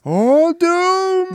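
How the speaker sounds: high, loud drawn-out wail; ominous echo. Retold or imitated. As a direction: wail it like a prophet of doom. Echoing the doom line, higher.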